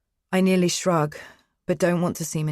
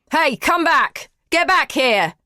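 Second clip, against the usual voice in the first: loud call after someone; shouting; 11 semitones higher, 8 dB louder, slower than usual. Acted. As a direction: yell it down the hallway. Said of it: "he shouts".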